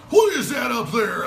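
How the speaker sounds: high, loud, slow mock shout; mock alarm. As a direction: yell it up at the sky like a hammy comic. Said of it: "Trevor Noah".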